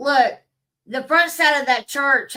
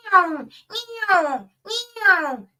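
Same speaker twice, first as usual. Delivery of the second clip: high, silly meows; meowing way up high, half laughing; mock meowing birds; 9 semitones higher, much slower than usual. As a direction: squeal the meows like a teasing bird. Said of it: "those birds are like".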